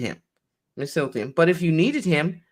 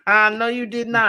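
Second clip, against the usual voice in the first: laughing; 5 semitones higher, 4 dB louder than usual. Real, not acted. Laughing rebuttal in retold exchange.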